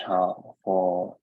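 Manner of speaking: ordinary talk, nothing marked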